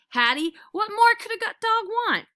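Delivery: high, loud and overjoyed; can't contain the happiness; dog sums up his perfect day; gushing. A narrator's reading.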